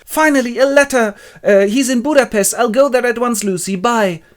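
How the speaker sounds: high, loud gushing relief; excited Mina. Acted. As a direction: burst out with joyful news, hammed-up. Mina receives fiancé's letter.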